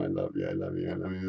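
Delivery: low, very soft repeated murmur; hushed, low retelling of endearments. Retold or imitated. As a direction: murmur it tenderly under your breath. Retelling tearful mutual endearments.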